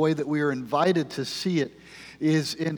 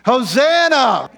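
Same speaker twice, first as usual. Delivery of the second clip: high, drawn-out shout, much louder; real yell; 11 semitones higher, 10 dB louder, slower than usual. Real, not acted. Pastor shows the kids how to shout in church, full yell. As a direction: yell it out long for the whole church. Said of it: "so I'm gonna yell".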